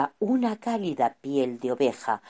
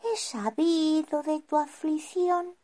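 put-on young wizard voice. Wizard appears.

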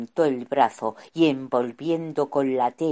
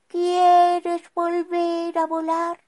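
put-on young wizard voice; 5 dB louder, slower than usual. Wizard asks slowly.

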